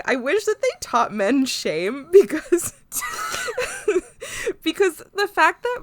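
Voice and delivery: high, loud, on the edge of breaking up; laughing rant. Real, not acted. Podcaster reading out a mean note, loud and laughing. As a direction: push the words out through laughter.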